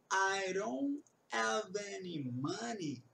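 slow, words blurred together; slow mumbled demo. Shown as a demonstration. Teacher slowly models the mumbled Brooklyn line. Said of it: "falar um pouco embolado".

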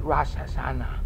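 "Rosh" as rahsh, run into "Hashanah"; everyday pronunciation. Shown as a demonstration. Teacher shows the average speaker's way, "Rosh" with an ah.

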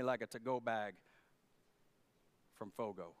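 soft, low, lazy plea to skip the hike; mock tired plea. Pastor begging Jesus for a day off, soft and low.

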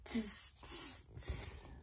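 very soft hissed monkey tss. A narrator's reading. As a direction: tease back like a cheeky monkey.

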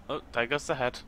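ordinary delivery

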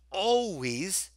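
"always" drawn out slowly; slow, weighty "always"; stress on always. Shown as a demonstration. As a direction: stretch out the word "always" for emphasis. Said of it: "I emphasize the word always".

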